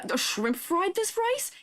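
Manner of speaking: high, slow incredulous meme voice; drawn-out high mock outrage at the rice; mock disbelief.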